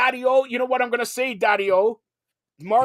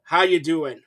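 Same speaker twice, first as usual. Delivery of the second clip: ordinary talk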